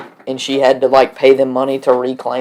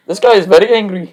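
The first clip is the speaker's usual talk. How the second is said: higher exaggerated angry-man accent; mock Indian accent; 6 semitones higher than usual. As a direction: play a fake furious foreign voice. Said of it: "So be like".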